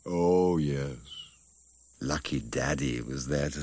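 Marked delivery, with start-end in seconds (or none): none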